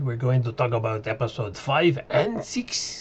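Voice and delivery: hammed-up French accent on the episode numbers; bad French accent. Reviewer announces episodes in a joking bad French accent. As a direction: fake a thick French accent. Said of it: "in my really bad French accent".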